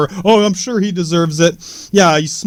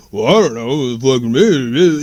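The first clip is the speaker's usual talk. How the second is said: mumbly Stallone; 5 semitones lower than usual. Retold or imitated. Mocking Stallone's take on the movie in a low mumble. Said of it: "mumbly Joe number two".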